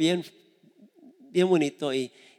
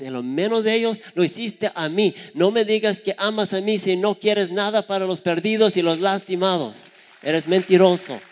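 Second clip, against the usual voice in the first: loud, higher rebuke; forceful preaching; 5 semitones higher, 6 dB louder than usual. Acted. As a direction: hammer the accusation home. Cristo reprocha, predicador enfático. (Cristo reprocha con fuerza)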